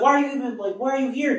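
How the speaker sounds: high-pitched, incredulous questioning; exasperated scolding. Retold or imitated. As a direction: play a brother demanding an explanation. Acting out brother scolding David.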